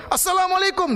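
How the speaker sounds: a high, loud deliberate greeting; loud shout. Retold or imitated. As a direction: shout the salam to the statue on purpose. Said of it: "saya sengaja keras". (salam diteriakkan keras dan tinggi)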